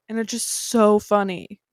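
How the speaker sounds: ordinary talk, nothing marked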